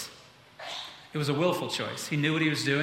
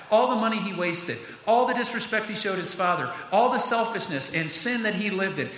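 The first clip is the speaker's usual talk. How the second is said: higher, pressing preacher's build; preaching build; 4 semitones higher than usual. Acted. Pastor urging the church about sinners, rising preaching. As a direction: drive it home to the congregation. Preacher rises to full force.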